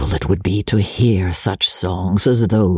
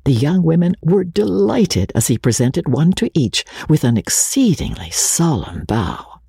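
plain reading voice

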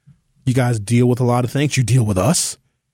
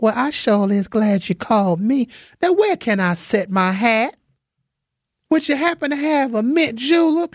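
feminine Southern belle; 11 semitones higher than usual. Acted. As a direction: coo like a genteel belle sipping mint juleps. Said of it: "she'd be so feminine".

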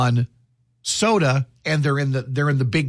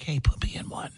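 whisper; 9 dB quieter than usual. Retold or imitated. Celebrities begging to be cast.

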